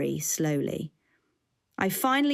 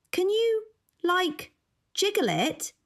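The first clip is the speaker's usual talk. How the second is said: much higher, bored woman voice; sarcastic high voice; 13 semitones higher than usual. A narrator's reading. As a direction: toss off a lazy suggestion. Jill's lazy suggestion.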